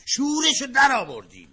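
exasperated outburst. Acted. Husband losing patience with his wife's nagging. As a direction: lose patience with her. Quarrel boils over.

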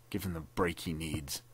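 low, soft muttered words; muttering. A narrator's reading. Captain muttering grimly to the lieutenant. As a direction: mutter it under your breath. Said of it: "muttered the captain".

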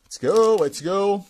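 loud, high burst of let's go; excited cheer. Real, not acted. Collector cheering as a new sticker pack opens, excitedly loud. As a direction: cheer the next pack open like a fan.

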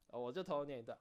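ordinary talk

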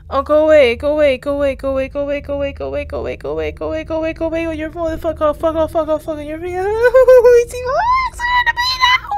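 rapid repeated pleading climbing to a very high squeal; mounting panic. Real, not acted. Viewer begging the scary thing to go away, rising panic. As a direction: start tense, end in high-pitched panic.

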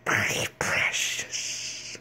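long, drawn-out creepy whisper; creature impression. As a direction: whisper it slowly, hissing the ending. Creature impression, then repeated.

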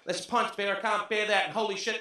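ordinary talk, nothing marked

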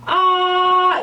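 아 high, mouth wide open; Chinese a. Shown as a demonstration. Teacher urging shy students to say 아 boldly, open and high. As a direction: open 아 from the throat, held out. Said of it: "창피하다 생각하지 마시고".

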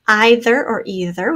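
first either as "eye-ther", second either as "ee-ther"; two ways to say either. Shown as a demonstration. Teacher to class, showing both ways to say either. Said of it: "whichever way you say that".

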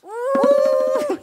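high startled squeal into a laugh. Acted. Dog Colin squeals and laughs as Hugo wakes. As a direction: squeal and giggle, then hide.

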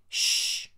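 loud unvoiced "shh"; sharp hush; hushing shush. A narrator's reading. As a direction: shush someone urgently. Peter Sam hushes Sir Handel.

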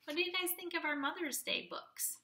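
ordinary talk, nothing marked

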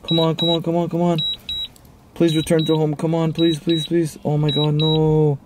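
normal talking voice

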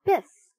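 high loud cartoon hit noise; loud playful sound effect; comic biff. A narrator's reading. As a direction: punch it out like a comic strip. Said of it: "went Geppetto".

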